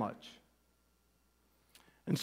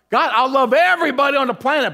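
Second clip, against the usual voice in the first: mock exclamation to God; 9 semitones higher, 8 dB louder, faster than usual. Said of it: "I'm like".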